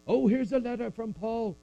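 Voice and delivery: high, bright announcing of the letter; excited church reader. Retold or imitated. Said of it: "reading it to the church".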